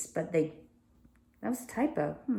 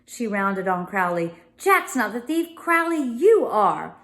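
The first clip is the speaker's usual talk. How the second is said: furious accusation; 6 semitones higher than usual. A narrator's reading. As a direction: round on the liar with fury. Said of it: "eyes gleaming furiously".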